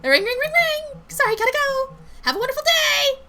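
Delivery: loud, very high fake ringing and farewell; frantically cheerful Lindsay. Coworker fleeing behind a fake phone call, high and loud. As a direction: sing it out high to escape. Character fakes call, flees brightly.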